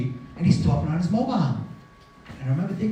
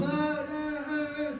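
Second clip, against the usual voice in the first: soft, high, drawn-out wail; quiet high keening down the phone; mother's wail; 10 semitones higher, 9 dB quieter, slower than usual. Retold or imitated. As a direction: imitate a distant wail, slow and high. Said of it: "the only thing I heard was".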